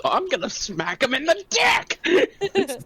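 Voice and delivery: slow build into a high comic squeal; mock battle cry. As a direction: squeal the threat in mock outrage.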